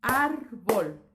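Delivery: "Árbol" in two loud beats, ár - bol; dividido en sílabas. Shown as a demonstration. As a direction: punch out "Árbol" as ár, pause, bol. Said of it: "como si estuviera dando una clase para niños". ("Árbol" marcado en ár - bol)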